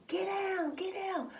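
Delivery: high urgent imitation of mom yelling; mock panicked yell. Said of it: "My mom screaming".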